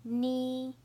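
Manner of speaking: "Ni" held high and level; first tone. Shown as a demonstration. Teacher showing the four tones, "Ni" high and level. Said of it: "I'll say the four tones".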